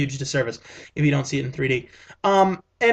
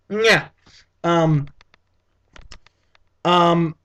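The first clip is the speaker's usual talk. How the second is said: ordinary talk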